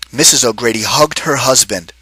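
ordinary delivery

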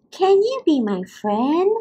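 hopeful little fish voice. Acted. Fish asks starfish.

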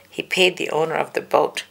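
usual speaking voice

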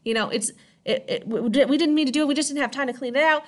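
high, rushed, pleading villagers; frantic apology. Retold or imitated. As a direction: beg forgiveness in a high panicked voice. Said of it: "And the townspeople go".

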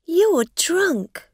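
high, loud indignant charge; angry accusation. A narrator's reading. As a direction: accuse with outrage.

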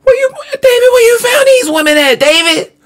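very high-pitched squealed question; incredulous outburst. Real, not acted. Reactor asking David about these women, incredulous squeal. Host reacts in disbelief to show.

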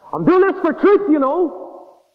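father proclaims while throwing his son out; self-righteous shout. Retold or imitated. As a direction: declare it like a zealot.

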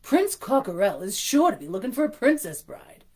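loud, gushing hen voice; trilling. Acted. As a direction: gush it out like a gossip. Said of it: "she trilled".

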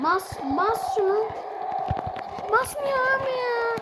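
high, frustrated whining at the phone; exasperated high whine. Real, not acted. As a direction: complain up high, near breaking. Tuş basmıyor, çaresiz yakınma. (telefona tiz sesle sitem)

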